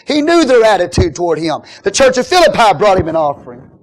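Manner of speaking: high, loud preaching run. Acted. Preacher telling of Paul's hard labour, full-force preaching. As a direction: drive it forward at full force.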